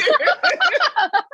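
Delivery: a high, loud burst of laughter. Real, not acted. Guest bursts out laughing at the Mickey Mouse answer, high laugh. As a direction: cracks up at the answer.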